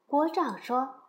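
plain reading voice

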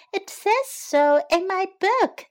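high-pitched chick voice; little chick. A narrator's reading. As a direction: insist as a clever little chick. Pip insists.

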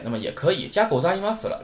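ordinary delivery

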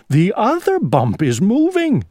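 high, alarmed cry. Acted. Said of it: "cried Owl".

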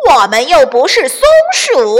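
loud, high derisive shout; villain hooting in scorn; scoffing weasel. A narrator's reading. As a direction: jeer at a silly suggestion. Weasels scoff at the chick's offer.

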